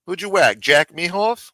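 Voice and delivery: usual speaking voice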